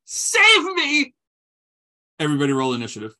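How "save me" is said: high, loud cry for rescue; desperate yell. Acted. Captive calls his friends for help, yelling. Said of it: "He yells".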